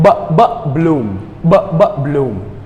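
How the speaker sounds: "b, bloom" then "b, b, bloom" with popped b's; sound drill. Shown as a demonstration. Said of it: "We say b".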